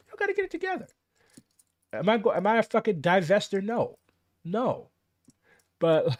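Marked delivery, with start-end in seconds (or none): 0.1-0.9 even higher, strained repeat; high strained exasperated repeat. Real, not acted. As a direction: squeeze it out high and tight.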